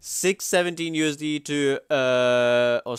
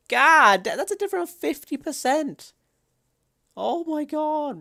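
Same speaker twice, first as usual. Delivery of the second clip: very high, squeaky disbelief; shocked disbelief; 6 dB louder than usual. Real, not acted. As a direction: voice shoots up in shock. Shocked at a fifty percent difference.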